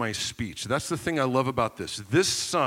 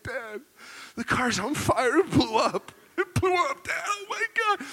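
high-pitched mock panic; retold panic; 13 semitones higher than usual. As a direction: wail the bad news to Dad, hammed up. Panicked son reporting burning car.

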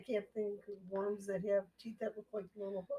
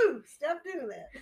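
imitated chick; 5 semitones higher than usual. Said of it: "that's what the chick says".

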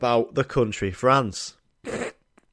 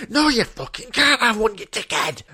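higher, different voice, snapping back; angry staff member; 9 semitones higher than usual. Retold or imitated. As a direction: bite back at the customer through the glass. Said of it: "got really close up and went".

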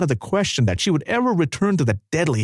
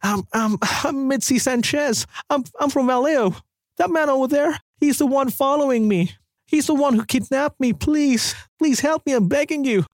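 sobbing plea; 12 semitones higher than usual. A narrator's reading. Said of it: "she answered as she sobbed".

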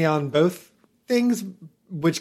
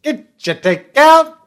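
loud carnival barker; 11 semitones higher, 10 dB louder than usual. Pretend. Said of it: "more of a barker".

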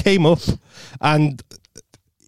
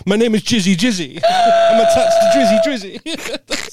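much higher, laughing, sing-song announcement; mock character intro; 9 semitones higher than usual. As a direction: introduce yourself as the silly Jizzy Jizzy. Singing mock intro of Jizzy.

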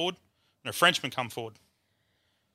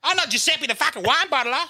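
high-pitched hammed-up wail; mock-sob the complaint in a very high voice; comically wailing, aggrieved; crying; 13 semitones higher, 4 dB louder than usual. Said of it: "Starts really fucking crying".

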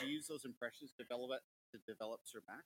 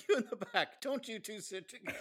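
higher, laughing mock strict nun; 8 semitones higher than usual. Friend imitating the nun scolding back-row pair, laughing. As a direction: tease like a bossy nun, laughing. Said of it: "totally own the character".

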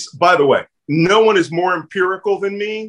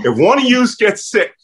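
Philly accent, pitched up, on "yous"; 6 semitones higher, 4 dB louder than usual. Real, not acted. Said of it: "That's my Philly accent coming".

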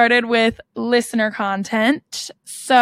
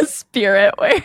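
laughing echo of a funny phrase; 10 semitones higher than usual. Real, not acted. Co-host tickled by the phrase spirit wear, laughing. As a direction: repeat it through real giggles.

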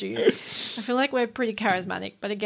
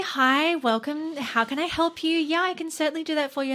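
high, chirpy phone-agent greeting; customer service voice; 7 semitones higher than usual. Shown as a demonstration. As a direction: lift it up high like a helpful receptionist. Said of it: "it starts up here".

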